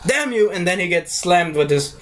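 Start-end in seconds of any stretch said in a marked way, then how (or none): 0.1-0.5 mock curse. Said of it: "He's like".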